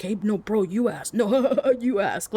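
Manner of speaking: giggly dude-bro egging his buddy on; lightly voiced bros pushing each other to ask; a hint of giggling frat guy; bro banter. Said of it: "like, bro banter".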